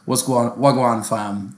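mock London roadman greeting; lightly put-on London street slang voice; London roadman accent. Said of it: "they were like".

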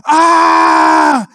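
high, loud burst of a yell as he loses it; sudden yell. Retold or imitated. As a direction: let out a startled, fed-up yell. Guy loses it in duck blind.

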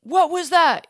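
loud, high startled shout; startled yell. A narrator's reading. Men in the boat yelling at the sudden noise. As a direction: yell in alarm at the crack. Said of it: "yelled the men".

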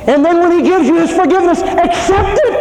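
fervent preacher's appeal; preaching shout. Acted. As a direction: urge them to accept it. Preaching climax.

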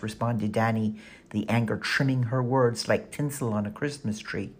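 ordinary delivery, nothing marked

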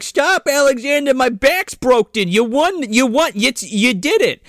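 pleading high voice. Retold or imitated. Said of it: "the guy's like".

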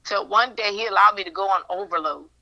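usual speaking voice

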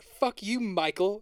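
hammed-up cartoon shout at Michael; mock yell. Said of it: "you're like".

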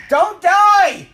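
screamed high and loud, begging; desperate shout. Pretend. Shouting to a sick friend, desperate scream. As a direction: cry out so they don't die.

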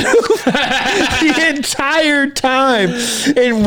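laughing. Real, not acted. Laughs while telling.

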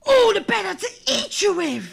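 snarling. A narrator's reading. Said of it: "snarled Granny".

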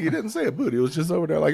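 ordinary delivery

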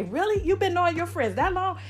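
high, incredulous question; lightly mocked disbelief; mock surprise. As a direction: act amazed like the doubters. Mimicking people's surprised question.

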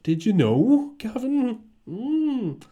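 high put-on voice, cooing at himself; flattering mirror self. Talking to himself in the mirror, high and cooing. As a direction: sweet-talk your reflection in a high voice. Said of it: "I tell myself nice things".

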